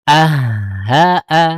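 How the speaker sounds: "Ah, ha, a" with a held breath of air before "ha", loud; doubled ha. Shown as a demonstration. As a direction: breathe out, hold, then sound "ha". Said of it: "ah, ditahan sedikit".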